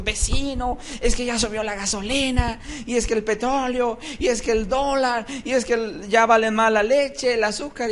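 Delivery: high-pitched, fretful list of worries; mock anxious neighbor. Neighbor fretting over prices to the believer, hammed-up panic. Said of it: "el vecino que no conoce a Dios tronándose los dedos".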